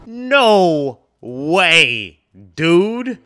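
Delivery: a loud, rising cry of shock; loud disbelief. Real, not acted. Viewer shocked at the betrayal on screen, loud disbelief.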